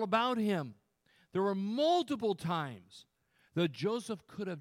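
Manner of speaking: loud, high-pitched pulpit urgency; forceful preaching. Real, not acted. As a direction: press the warning on the congregation.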